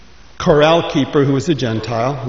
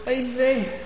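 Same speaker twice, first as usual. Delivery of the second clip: higher, softer sigh of dread; relieved dismay; 9 semitones higher, 7 dB quieter than usual. Acted. Moshe stares down the cliff, higher and shaken. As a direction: peer over the drop, shaken. Looks over cliff edge.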